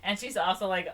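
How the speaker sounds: ordinary delivery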